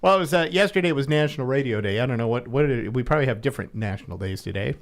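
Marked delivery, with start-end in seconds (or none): none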